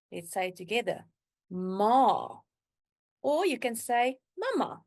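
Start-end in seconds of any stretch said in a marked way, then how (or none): 1.5-2.4 "ma" with a long, drawn-out A; long vowel. Shown as a demonstration. Teacher introduces the Afrikaans word for mom, "ma" held long. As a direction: stretch the A in "ma". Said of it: "the sound of the A is very long".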